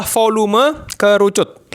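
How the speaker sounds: words pushed out through a burp; talking while burping. Real, not acted. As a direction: burp breaking into the line. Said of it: "Sorry, sendawa".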